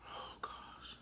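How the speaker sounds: soft whispered reaction; whispering. Real, not acted. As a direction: breathe it out. Reacting to sourness.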